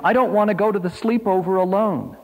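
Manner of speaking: put-on high-pitched child's protest; urgent little-boy plea; pleading call. A narrator's reading. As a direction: plead like a small boy, high all through, dropping at the end. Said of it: "Richard called down to him".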